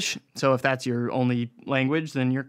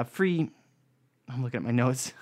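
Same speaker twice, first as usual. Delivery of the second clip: normal talking voice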